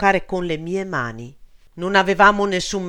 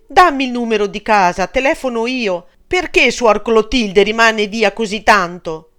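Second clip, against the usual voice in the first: higher, louder, urgent pleading for news of family; anxious pleading; 7 semitones higher, 8 dB louder than usual. Acted. Girl begging the nun to phone home, urgent and pleading. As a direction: press the nun, desperate to call home.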